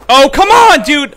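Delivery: loud, high burst of frustration at the game; frustrated yell. Real, not acted. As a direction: yell it out as the round falls apart. Teammates failing in a match.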